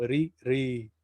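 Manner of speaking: "Ri, ri" with the vowel held twice as long; long "ri" shown for the barred R; doubled ri. Shown as a demonstration. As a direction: stretch the i in "ri". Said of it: "ahí se va a doblar el tiempo".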